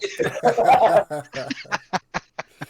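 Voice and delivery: big burst of laughter; loud laughter. Real, not acted. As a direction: crack up loudly.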